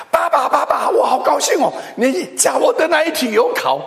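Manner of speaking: put-on high, bright child's cry of joy; a little girl thrilled to see her dad; excited daughter. 女儿考完试兴奋地冲向爸爸，高声欢叫.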